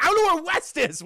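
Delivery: high, loud flustered cry; mock panic. Captain lost in the vents to crewmate, panicking. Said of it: "And he's panicking".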